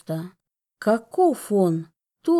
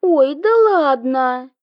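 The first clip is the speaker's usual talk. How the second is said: dismissive wave-off; 5 semitones higher, 5 dB louder, slower than usual. Acted. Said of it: "отмахнулась сестра".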